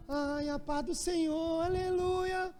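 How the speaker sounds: slow, breathy-sounding sigh rising high on aleluia; mock pious sister swooning; drawn-out churchy sigh, climbing to a high aleluia; imitating church sisters. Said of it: "as irmãs ficavam".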